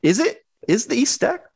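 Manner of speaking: high, questioning inner voice; mock doubt. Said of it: "I'm like".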